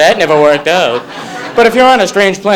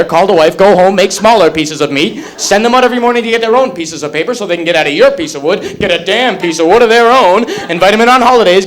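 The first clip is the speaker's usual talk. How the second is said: normal talking voice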